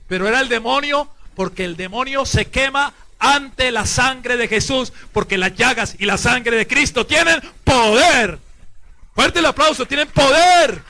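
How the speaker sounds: loud, high, drawn-out proclamation building to a shout; full-force preaching. Real, not acted. Priest calling the congregation to applaud Christ's power, shouted preaching. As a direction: preach to a roaring climax.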